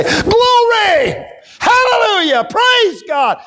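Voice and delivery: very high ringing cries of praise; joyful shout. Shown as a demonstration. Preacher showing the church how to praise, shouting. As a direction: shout it out in worship. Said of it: "You just have to say".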